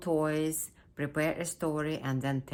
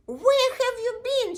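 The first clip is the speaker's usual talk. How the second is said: very high, loud squealed question; shrieky dog-mother voice; mama dog; 11 dB louder than usual. Acted. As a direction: cry out to a found child. Mama dog finds her puppy.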